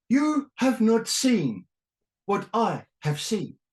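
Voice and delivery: high, slow, pompous pronouncement; grand philosophical voice. Retold or imitated. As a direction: intone it like a solemn sage. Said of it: "has to be a philosophical statement".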